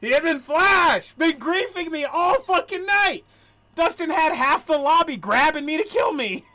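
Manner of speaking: high, very loud rant; furious yelling. Real, not acted. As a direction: yell your grievance at full volume, really mad. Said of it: "I'm so mad".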